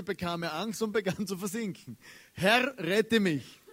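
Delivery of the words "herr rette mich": slow, louder plea with a laugh in it; half-laughing cry of a sinking man; mock cry for help. Preacher acting sinking Peter begging the Lord, slow mock cry. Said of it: "schrie er".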